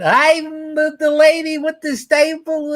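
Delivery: very high, goofy comic holler; hammed-up wail in a cartoonish comedian voice; Jerry Lewis. Comedian clowning with a stapler, high mock wail. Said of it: "he did the emotional Jerry".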